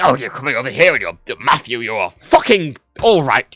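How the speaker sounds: mock English accent, slowing on the last words; harsh English. English person welcoming him over, harsh mock English. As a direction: imitate the English version with full "Matthew". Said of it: "When you go to England".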